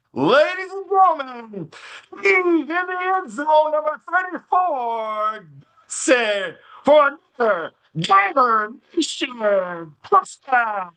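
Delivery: high, slow, drawn-out touchdown call; stadium announcer. Shown as a demonstration. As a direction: boom it out like a PA announcer. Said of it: "what it sounds like when ... touchdown".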